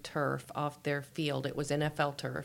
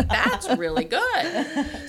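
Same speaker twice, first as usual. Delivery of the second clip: mock impressed; 9 semitones higher, 7 dB louder, much slower than usual. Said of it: "I was like".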